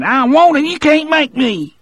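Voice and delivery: high, loud petulant refusal; childish defiance. A narrator's reading. Percy refusing Broodle's order, childish high defiance.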